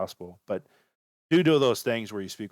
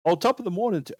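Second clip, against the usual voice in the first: higher, louder mock British greeting; attempted British accent; 9 semitones higher, 4 dB louder than usual. Shown as a demonstration. Showing Indians how to sound British, attempted British accent. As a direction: have a rough go at a British accent. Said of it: "speak like a British person".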